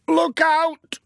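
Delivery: high, loud sudden cry of warning; shouted warning. A narrator's reading. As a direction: yell out to stop him in time. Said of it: "when Alf shouted".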